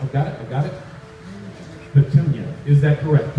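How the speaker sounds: normal talking voice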